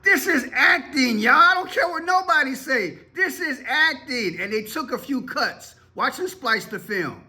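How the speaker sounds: very high-pitched, exasperated dismissal; scornful disbelief. Real, not acted. Commentator calling the played clip staged, scornfully incredulous. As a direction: protest in a high voice, drawing out key phrases. Host rejects the clip as acting.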